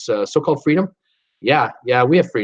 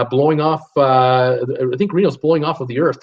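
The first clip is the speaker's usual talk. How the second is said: ordinary talk, nothing marked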